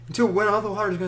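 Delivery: ordinary talk, nothing marked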